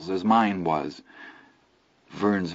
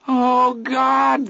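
much higher frightened cry; terrified scream; 4 dB louder than usual. A narrator's reading. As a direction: shriek in panic. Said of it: "Vern screamed".

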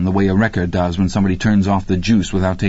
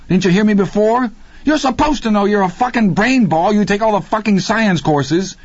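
high, pushed near-scream; yelling at Randy in panic; almost screaming; 13 semitones higher than usual. Acted. Deke berating Randy for not knowing, almost screaming. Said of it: "Deke was almost screaming himself".

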